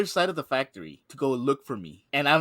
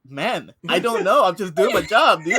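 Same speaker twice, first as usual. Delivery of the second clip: loud, pitched-up mock exasperation; exasperated protest; 5 semitones higher, 8 dB louder than usual. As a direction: replay a defensive protest, louder and higher. Defends self in retold quarrel.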